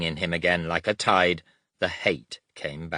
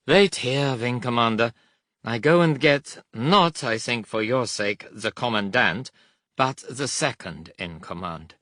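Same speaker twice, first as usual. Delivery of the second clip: put-on raised-pitch German accent, falling away; resigned German officer; 5 semitones higher, 4 dB louder than usual. A narrator's reading. German guard goes to fetch second in command, resigned. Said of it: "he said with resignation".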